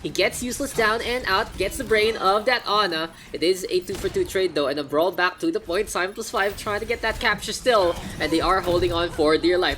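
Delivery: loud, high, fast esports casting; hyped play-by-play. Acted. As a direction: call the fight like a caster on the edge of his seat.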